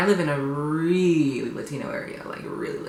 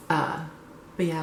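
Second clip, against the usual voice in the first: ordinary talk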